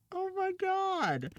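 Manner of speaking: very high, slow drawn-out gasp; stunned. Real, not acted. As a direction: stretch it out in disbelief. Reacting to tangled hair.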